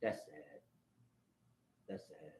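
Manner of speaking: low, very soft repeated verdict; hushed dismay. Real, not acted. Man lamenting soldiers who walked off, hushed. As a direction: let it fade almost to nothing. Subdued verdict after the shouting.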